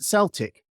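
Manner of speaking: "Celtic" as SELL-tick, like the team; football-club "Celtic" with soft C. Shown as a demonstration. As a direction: begin "Celtic" with an S. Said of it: "they pronounce it".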